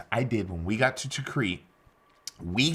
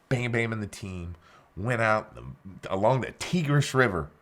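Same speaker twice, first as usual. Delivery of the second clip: normal talking voice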